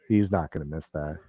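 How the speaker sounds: normal talking voice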